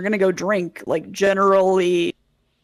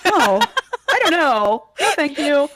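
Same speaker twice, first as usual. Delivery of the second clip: flustered; 8 semitones higher, 6 dB louder than usual. Acted. Flustered refusal of flirting.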